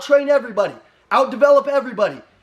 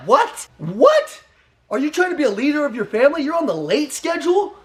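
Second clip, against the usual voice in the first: incredulous; 5 semitones higher than usual. Retold or imitated. Retold disbelief at late coworker.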